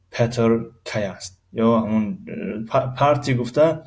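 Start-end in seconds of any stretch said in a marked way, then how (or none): none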